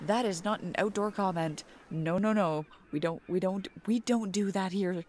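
ordinary talk, nothing marked

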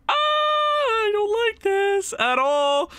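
high, slow drawn-out whine; anxious wail. Real, not acted. Fan dreading the tied game, a high slow wail. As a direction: moan it out in dread.

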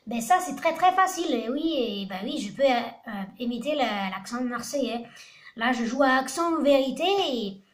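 put-on southern French Marseille accent. Shown as a demonstration. Player showing off on a dare that he can imitate it, Marseille accent. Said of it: "Imite l'accent marseillais".

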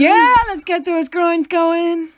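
loud, drawn-out rallying call; mock gung-ho cheer. Geography fan rallying the class, loud mock enthusiasm. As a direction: whoop it up like a keen field-tripper.

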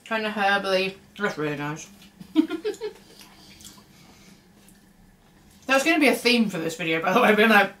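talking while eating chocolate; muffled through fingers; chewing with hand over mouth. Real, not acted. Seen 0: Woman chews, repeatedly covering mouth with hand while talking about chocolate.

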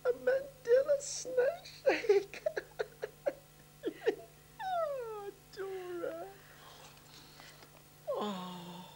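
overwhelmed by the gift, voice breaking into tears; tearful laughing. Real, not acted. Moved by birthday gift from maid.

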